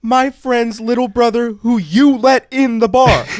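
slow, high, emphatic accusation; hammed-up outrage edged with laughter; mock indignation. Mock rant at the bouncer.

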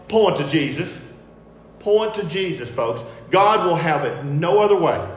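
driving, emphatic pulpit delivery; forceful preaching. Real, not acted. Preacher urges the church to point to Jesus, forceful. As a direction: hammer the point home to the congregation.

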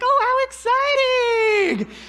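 very high, loud gushing "how exciting"; gushing wife. Retold or imitated. Wife thrilled about the TV invitation, gushing high and loud. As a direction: squeal with delight like the wife. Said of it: "she's like".

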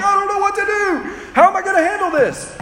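panicky mock voice. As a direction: play the frantic listener. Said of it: "y'all are all like".

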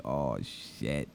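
a low, quiet retold reaction of being caught; hushed dread. Clerk realising the boss heard him, hushed and low.

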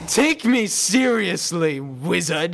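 high, loud, slow royal drawl; pompous king. Acted. King dismissing the party in a hammed-up royal voice. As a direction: lord it over the strangers.

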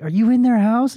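high, loud disbelieving question; re-enacted parental astonishment; incredulous dad. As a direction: ask the child in disbelief. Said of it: "And I'm like".

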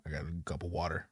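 usual speaking voice

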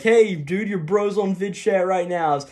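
high, loud acted "hey, dude"; hammed-up friendly call; excited heads-up. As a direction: imitate a pal with news, drawn out. Friend excitedly brings phone over, retold.

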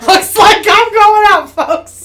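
loud, slow announcer farewell; hammed-up dramatic goodbye; mock farewell.

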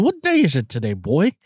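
hammed-up old-time call to a boy; mock theatrical Christmas Carol voice; Christmas Carol character. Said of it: "Christmas Carol".

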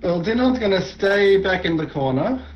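ordinary delivery

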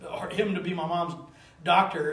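ordinary talk, nothing marked

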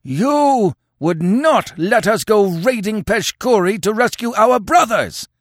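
high, loud, strained accusation; accusing shout. Acted. Old chief blames Conan before the warriors, shouting accusingly. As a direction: throw the blame at full pitch. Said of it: "and shouted accusingly".

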